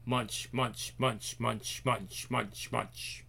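very soft, low, rhythmic repetition; quiet munching. A narrator's reading. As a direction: chew through each word under your breath. Eating noises read as words.

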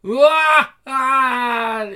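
loud, high drawn-out wail; hammed-up character screaming; acted scream. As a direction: wail at the top of the voice. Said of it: "Is someone screaming?".

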